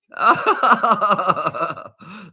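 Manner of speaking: long, high peal of laughter; delighted laugh. Real, not acted. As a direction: burst out laughing loudly in delight. Laughing at the monkey.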